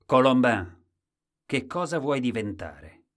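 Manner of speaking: booming royal question; the king. A narrator's reading. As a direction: summon and question like a monarch. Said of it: "quando il re domandò".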